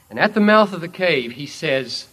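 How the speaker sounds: ordinary talk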